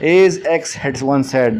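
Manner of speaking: very slow, drawn-out example phrase; deliberate teaching read of a quote opener; slow model phrase. Shown as a demonstration. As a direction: lay each word down slowly for the class. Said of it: "اگر آپ کسی کی کوئی حوالہ دیتے ہیں".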